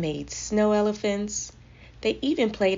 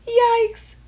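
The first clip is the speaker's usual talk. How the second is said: very high, loud squeal of alarm; alarmed cry; 11 dB louder than usual. A narrator's reading. Monkeys tumbling down the cliff, alarmed cry. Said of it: "cried the monkeys".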